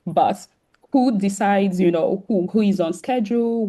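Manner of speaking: ordinary delivery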